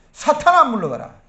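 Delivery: sudden loud, high command, then easing; acted thundering reprimand; shouted rebuke. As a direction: burst out as if banishing Satan. Acts out Jesus rebuking Peter.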